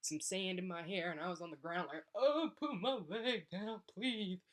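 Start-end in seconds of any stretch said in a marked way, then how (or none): 2.1-4.4 slow, high mock wail; hammed-up tearful pleading; garbled crying. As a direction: whine it through exaggerated sobs, words smeared. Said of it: "you can't understand what I'm saying".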